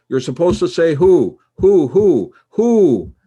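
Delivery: loud, high blurt, losing patience; exasperated outburst. Retold or imitated. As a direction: lose it and blurt it out, each WHO loud and high. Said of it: "just lost it and I blurted out".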